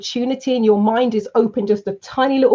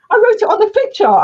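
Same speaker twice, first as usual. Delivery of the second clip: emphatic; 11 semitones higher, 5 dB louder than usual. Retold or imitated. Mocking the hostile trainee's answer.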